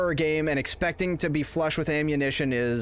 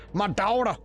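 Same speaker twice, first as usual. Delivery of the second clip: put-on would-be Southern accent, pitched up; Nicolas Cage's bad Southern accent; 4 semitones higher than usual. Mocking the actor's film accent, bad Southern twang. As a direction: exclaim it in a botched Southern accent. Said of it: "I don't know what the fuck that accent was, but it wasn't Southern".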